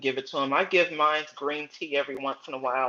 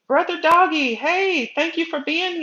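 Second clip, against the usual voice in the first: high, bright-eyed hello to a viewer; excited greeting; 12 semitones higher, 5 dB louder than usual. Real, not acted. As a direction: greet a friend who just joined, up high.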